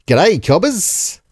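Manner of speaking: broad Aussie greeting, lifted at the end; Australian accent. Shown as a demonstration. Host demonstrating his Australian accent for cohosts, broad. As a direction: hail them in exaggerated Australian. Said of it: "gonna do an Australian accent".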